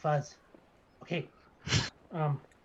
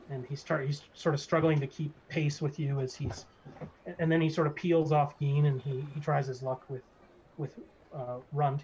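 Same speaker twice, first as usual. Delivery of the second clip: ordinary delivery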